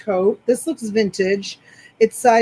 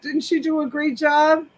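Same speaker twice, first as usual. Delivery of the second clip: ordinary talk, nothing marked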